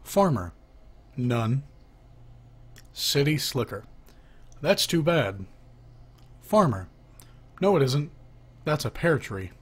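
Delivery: ordinary delivery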